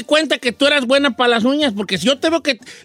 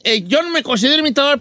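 usual speaking voice

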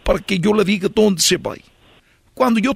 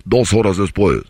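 deep narrator voice; 12 semitones lower than usual. Acted. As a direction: announce the time skip gravely. Narrator time-skip caption.

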